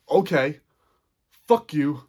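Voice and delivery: loud burst of hurt defiance; defiant outburst. Retold or imitated. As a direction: throw it back hard and defiant. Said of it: "where I was just like".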